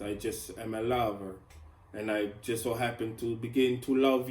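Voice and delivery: put-on playful, pleading, vaguely Italian accent; mock Italian accent. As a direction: declare love in a badly faked Italian accent.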